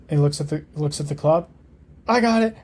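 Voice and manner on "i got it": high loud answer; eager reply. Acted. Rose answering Harry during the troll fight, eager reply. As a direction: call back with confidence.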